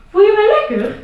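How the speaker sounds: high, loud, caring mother; mother's voice. Acted. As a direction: ask it like a fussing mum. Mother asks son, concerned.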